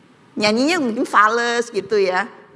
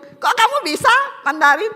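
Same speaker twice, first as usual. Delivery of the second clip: husband's surprise; 11 semitones higher than usual. Retold or imitated. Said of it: "Suami saya bingung".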